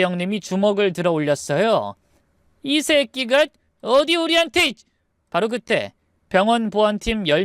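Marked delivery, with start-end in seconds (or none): 2.6-4.7 high, enraged curse; furious threat. Acted. Second brother raising a fist at his in-law, furious. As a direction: lash out with pitch way up.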